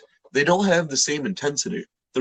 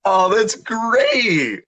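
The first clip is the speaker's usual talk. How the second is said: excited; 10 semitones higher, 5 dB louder, much slower than usual. Real, not acted. Delighted reaction.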